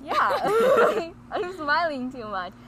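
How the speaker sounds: breathless from laughing; laughing, cheeks aching; after laughing hard. Real, not acted. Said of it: "my cheeks hurt".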